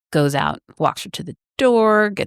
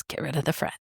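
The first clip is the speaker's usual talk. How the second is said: hushed words into an ear; quiet aside; 10 dB quieter, much faster than usual. Retold or imitated. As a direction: murmur it privately. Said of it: "quietly in his ear".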